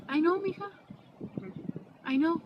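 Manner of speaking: imitating dad gently. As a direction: play dad saying he always knew. Dad voiced accepting coming out.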